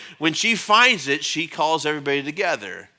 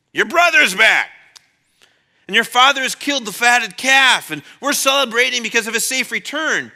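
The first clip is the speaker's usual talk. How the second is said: excited servant; 6 semitones higher, 7 dB louder than usual. Retold or imitated. Servant's reply in story.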